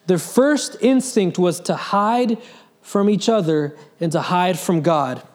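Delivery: ordinary delivery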